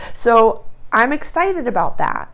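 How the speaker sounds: ordinary delivery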